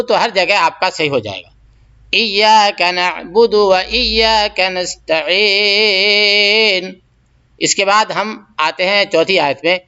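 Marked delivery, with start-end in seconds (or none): 2.1-6.9 slow, drawn-out chanted recitation; Quran recitation. Shown as a demonstration. Teacher reciting Fatiha verse for students, slow chant. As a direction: recite each word slowly and clearly.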